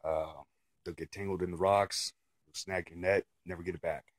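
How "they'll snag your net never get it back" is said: ordinary talk, nothing marked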